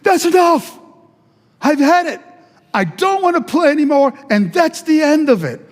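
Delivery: loud, high final refusal to play. Retold or imitated. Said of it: "Tony raises his voice".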